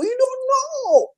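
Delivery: high, long sung-out know; sermon cry up high; drawn-out high. Acted. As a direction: stretch it high and slow. Preacher stretching a repeated phrase.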